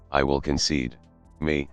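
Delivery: plain reading voice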